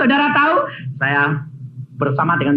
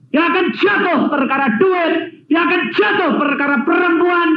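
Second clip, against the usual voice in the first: preaching at full force; 10 semitones higher than usual. Acted. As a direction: thunder it from the pulpit.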